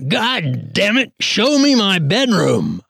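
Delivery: slow, high furious bellow; roaring. A narrator's reading. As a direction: roar it at the corporal, slow and high. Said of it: "Patton roared at the corporal".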